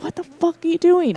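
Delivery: mock freak-out at a friend. To a friend entering the green room, freaking out. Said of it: "I'm freaking out".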